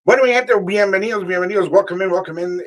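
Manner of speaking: high, loud radio host welcome; show host intro. Acted. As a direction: hype up the audience like a DJ.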